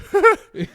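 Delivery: sudden loud high laugh. Real, not acted. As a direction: burst out laughing loudly. Laughs mid-story.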